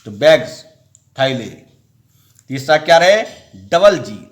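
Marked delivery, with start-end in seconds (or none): 0.1-0.6 बैग्स with a buzzing voiced ending, like बैग्ज; final s as j. Shown as a demonstration. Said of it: "जौ होता है".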